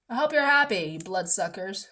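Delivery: ordinary delivery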